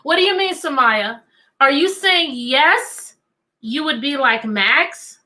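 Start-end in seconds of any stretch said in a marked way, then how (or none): none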